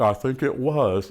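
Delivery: slow, low British psychiatrist; British accent. Retold or imitated. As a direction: imitate a British doctor, low and unhurried. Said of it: "in a British accent".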